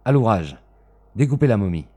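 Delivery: loud, sharp command. Acted. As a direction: bark the order twice. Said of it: "dit-il".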